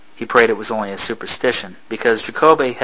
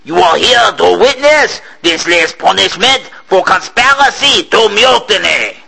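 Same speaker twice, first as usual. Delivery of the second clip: higher, louder captain's proclamation; captain shouting to the assembled slaves; shouted; 9 semitones higher, 5 dB louder than usual. A narrator's reading. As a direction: announce it over the wind. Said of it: "he shouted".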